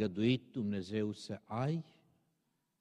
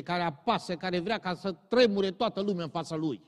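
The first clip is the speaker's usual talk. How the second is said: higher and louder, driving pulpit voice; fiery preaching; 6 semitones higher, 8 dB louder than usual. Acted. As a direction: thunder the warning at abusive bosses. Preaching at full force. (predică pătimașă, mai sus și mai tare)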